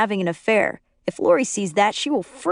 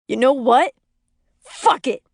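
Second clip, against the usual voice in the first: mildly raised, higher exasperated yell; 4 semitones higher, 3 dB louder than usual. A narrator's reading. Danny throwing his hands up, exasperated yell. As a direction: throw it out in frustration. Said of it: "Danny yells".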